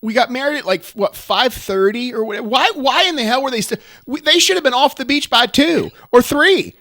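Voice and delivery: loud, high, twangy rant about beachgoers; mad Southern twang. Real, not acted. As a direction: get worked up and let the twang come out. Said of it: "crazy accent when you get mad".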